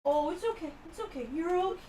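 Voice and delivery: calm the scared cat gently; soothing pet talk. Real, not acted.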